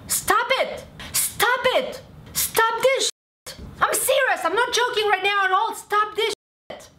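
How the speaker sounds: high-pitched angry yelling. Real, not acted. Furious creator yelling at customers to stop. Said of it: "Yes, I'm yelling".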